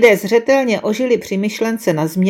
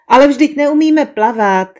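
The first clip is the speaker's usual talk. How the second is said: mildly desperate, higher-pitched protest; desperate cry; 6 semitones higher, 4 dB louder than usual. A narrator's reading. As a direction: cry out in dismay, without shouting. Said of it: "vykřikla zoufale jedna z žen". (mírně zoufalé zvolání)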